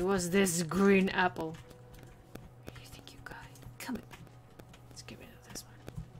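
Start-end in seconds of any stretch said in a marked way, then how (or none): 2.7-4.0 hushed conspiratorial whisper. Acted. Secretive aside while playing. 4.9-6.1 hushed conspiratorial whisper. Acted. Secretive aside while playing.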